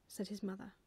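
plain reading voice

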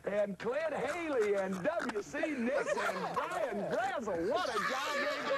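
high, slow, chuckling list; laughing. Real, not acted. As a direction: laugh through the names, pitched high. Coach joking, laughing through names.

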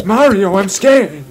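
high, loud cartoon fright; hammed-up terror; mock scared Luigi. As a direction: squeal it like a frightened little brother.

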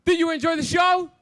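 loud, upbeat stage host; upbeat cruise director. Real, not acted. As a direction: hype up the crowd after the show. Cruise director hosting stage show.

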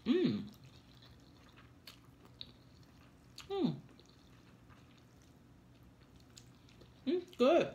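slurping noodles while humming; chewing with closed lips; mouth full of noodles. Real, not acted. Seen 0: Noodles hang from her mouth; she slurps and chews while humming.

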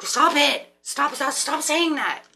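high, loud stern scolding voice; be the inner voice telling her off; loud mock scolding from her intuition. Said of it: "your intuition's like".

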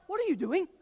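high, loud mock-alarmed wife; wife's alarm. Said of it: "my wife's like".

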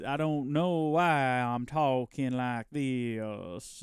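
slow mock-solemn drawl on "why"; dragging each word out in a pompous drawl; slow drawn-out repeat. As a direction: repeat it very slowly, stretching "why".

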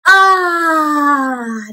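a drawn-out contented "Ah"; the catchphrase "Ah", said for the class; relaxed "Ah". Acted. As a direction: let out a happy couch sigh. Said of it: "He always says".

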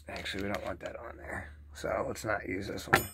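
soft whisper to himself; whispered aside. Real, not acted. Working at the press, whispering to himself. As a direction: whisper it under your breath.

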